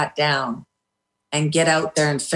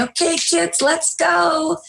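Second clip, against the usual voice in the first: much higher, happy coach voice; put-on cheerful; 11 semitones higher, slower than usual. Coach hiding her distress from the kids, forced cheerful. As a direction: fake a big smile and rally the kids. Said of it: "put on a big happy smile and say".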